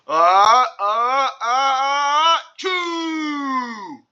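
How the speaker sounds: building uh-uh-uh, rising into a loud choo; big sneeze. Acted. Andrew sneezing from the pepper, building sneeze. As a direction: wind up and sneeze. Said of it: "Andrew went".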